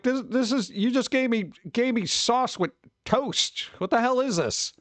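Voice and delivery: high-pitched, baffled complaint; mock indignant. Complaining to whoever served sauce on toast, mock indignant. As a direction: act out baffled indignation, dragging the key words. Retold complaint about wrong pizza.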